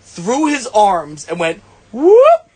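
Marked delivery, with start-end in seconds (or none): 1.9-2.5 high, loud rising whoop; quick imitated whoop. Describing a ball slipped through his arms, imitated whoop. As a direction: let out a sudden upward "whoop". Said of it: "like that".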